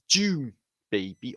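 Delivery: ordinary talk, nothing marked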